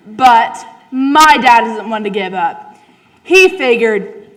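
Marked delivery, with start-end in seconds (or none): none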